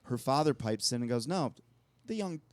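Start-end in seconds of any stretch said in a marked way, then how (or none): 1.3-2.4 put-on a light, half-hearted Irish accent, quite high. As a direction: try an Irish old man and fall short. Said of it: "I can't do my Irish accent".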